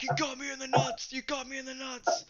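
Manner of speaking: high whiny mock wail; hammed-up injured yelp; mock screaming. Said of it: "Instantly start screaming".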